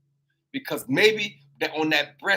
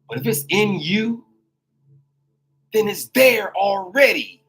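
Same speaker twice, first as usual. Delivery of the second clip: full-force preaching; 3 semitones higher than usual. Acted. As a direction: lift the congregation, preach it out.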